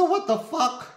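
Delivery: high-pitched retold disbelief; acted shock, high but not shouted; shocked outburst. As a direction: re-enact a startled cry, pitch leaping up. Shocked at sudden punch, retold.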